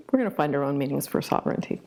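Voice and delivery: ordinary talk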